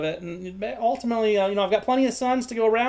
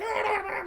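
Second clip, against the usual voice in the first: high, quiet gabbling squeal; imitated squeals of a goblin scrap; scuffling goblin noises; 14 semitones higher, 6 dB quieter than usual. Two goblins scuffling in a corner, high squabbling noises. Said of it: "two of them start scuffling".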